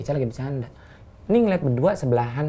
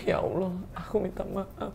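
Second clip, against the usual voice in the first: slow, soft, higher mock plea; hammed-up remorse, very quiet; fake tearful apology; 6 semitones higher, 12 dB quieter, slower than usual. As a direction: pretend to be on the verge of tears. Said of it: "Ini dong tetes mata biar kelihatan nangis".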